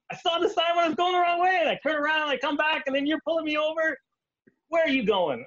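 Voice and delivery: hammed-up frantic protest; exasperated shouting. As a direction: yell the whole excuse in one breath.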